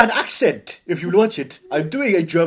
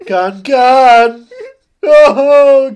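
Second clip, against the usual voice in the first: loud mock call across a room; 4 semitones higher, 9 dB louder, much slower than usual. Calling Nicole Kidman back from Cannes, loud. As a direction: shout for her as if she's far off.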